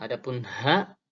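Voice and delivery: ordinary delivery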